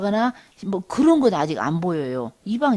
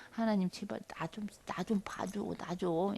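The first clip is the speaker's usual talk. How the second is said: much softer, half-whispered plea; hushed pleading prayer; 14 dB quieter than usual. Retold or imitated. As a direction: beg God under your breath. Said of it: "예, 이런 거지요". (숨죽여 애원하는 기도)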